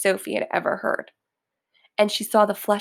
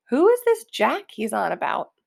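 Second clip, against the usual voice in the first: usual speaking voice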